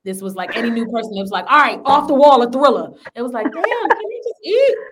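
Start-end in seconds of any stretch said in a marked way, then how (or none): 1.5-2.9 loud, emphatic quiz question; acted-out challenge. Putting the album question to newcomers on tour, loud acted challenge. 3.4-4.8 high, drawn-out mock complaint; mock protest. Newcomer on tour hit with the question, high mock protest.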